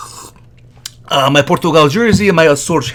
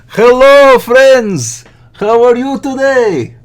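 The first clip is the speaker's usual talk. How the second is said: big hello; 7 semitones higher, 5 dB louder, slower than usual. Acted. Big on-mic greeting.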